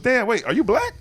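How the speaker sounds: high-pitched surprised question; loud high mock surprise. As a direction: imitate a startled realization. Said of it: "He goes".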